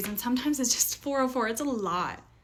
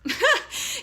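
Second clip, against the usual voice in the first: short high-pitched laugh; amused laugh; 4 dB louder than usual. Real, not acted. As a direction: burst into a brief giggle. Amused by her own suggestive remark.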